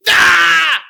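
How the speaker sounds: sudden high yelp of a fall; mock yelp. Shown as a demonstration. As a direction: cry out as if tripping. Demo of someone tripping.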